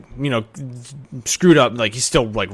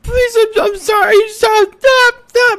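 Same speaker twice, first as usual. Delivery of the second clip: put-on very high, loud, pleading child voice; crying plea; 8 dB louder than usual. GM as the beaten elf boy begging the bully, crying. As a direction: beg him to stop through tears. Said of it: "little guy is crying".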